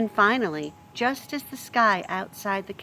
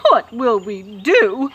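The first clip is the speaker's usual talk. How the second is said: slow, louder mournful wail; 3 semitones higher, 9 dB louder, slower than usual. Acted. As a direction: wail it helplessly. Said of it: "wailed Cook".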